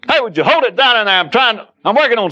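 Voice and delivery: high-pitched annoyed holler; irritated hollering. Acted. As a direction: holler crossly at whoever is making noise. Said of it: "You're hollering".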